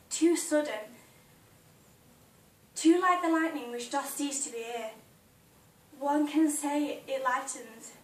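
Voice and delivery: projected, emphatic Juliet audition speech; dramatic monologue. Acted. Juliet pleading with Romeo on the balcony, dramatic monologue. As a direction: declaim it with passion, loud on the key phrases.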